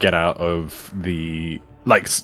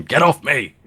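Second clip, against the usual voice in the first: louder, high-pitched yell; screamed protest; 8 semitones higher, 5 dB louder than usual. Acted. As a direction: cry out to be let go, acting in character. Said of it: "like, like, scream".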